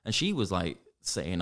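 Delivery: normal talking voice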